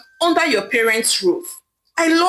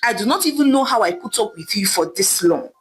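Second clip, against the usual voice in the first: plain reading voice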